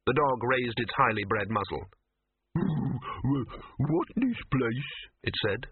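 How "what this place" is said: very slow, high, dopey dog; slow, vacant dog voice; dim dog voice. Acted. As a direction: drag it out like a dim-witted hound. Dim wonder dog speaks.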